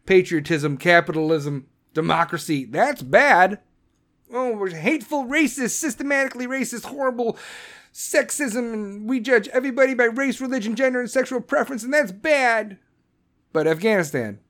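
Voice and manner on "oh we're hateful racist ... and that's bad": higher, sarcastic listing of insults; mock the accusations in a raised voice; a sneering, raised-pitch list of charges; sarcastic mockery.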